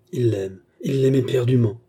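plain reading voice

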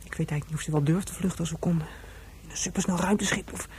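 ordinary delivery

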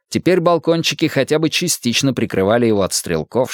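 plain reading voice

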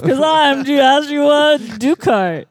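hammed-up cockpit sign-off, slowly stretching the name; mock pilot announcer.

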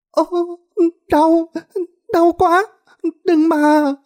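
very high, whimpering madwoman; tearful mumbled moaning. A narrator's reading. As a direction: wail in pain and beg them to stop. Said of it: "mặt mếu máo rồi ú ớ". (giọng bà điên mếu máo, rên rỉ)

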